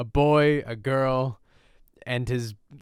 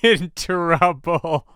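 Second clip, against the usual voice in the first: loud warning; 5 semitones higher, 8 dB louder, slower than usual. Real, not acted. Warning the girl on screen.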